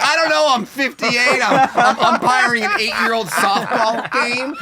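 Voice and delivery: high, loud, laughing put-upon umpire; weary umpire. Retold or imitated. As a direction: play the fed-up old umpire. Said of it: "He's like".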